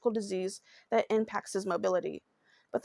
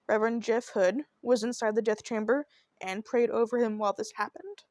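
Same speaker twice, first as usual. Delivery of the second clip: ordinary talk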